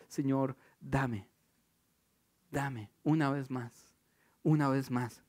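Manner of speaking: soft, slow pleading prayer; quiet prayer. Shown as a demonstration. Preacher showing the congregation how he prays, soft and slow. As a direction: plead gently with God. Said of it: "Por eso yo pido a veces".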